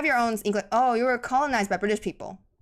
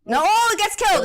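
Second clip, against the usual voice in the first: real shocked shout, pitched much higher; shocked outcry; 12 semitones higher, 8 dB louder than usual. Real, not acted. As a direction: cry out loudly in disbelief at the play. Shocked reaction to game play.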